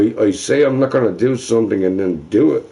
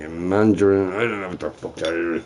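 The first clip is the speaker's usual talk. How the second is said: ordinary talk, nothing marked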